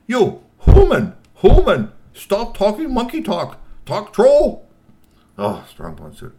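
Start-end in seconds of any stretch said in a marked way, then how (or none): 0.1-4.7 very high, loud, slow scolding; a shrill troll boss barking orders; bossy troll sister. Acted. Fidget scolds Strongbones for monkey talk, high and loud.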